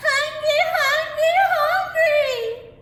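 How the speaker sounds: put-on very high, loud baby wail; hollering baby. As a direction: wail like a hungry giant baby. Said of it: "The baby began to holler too".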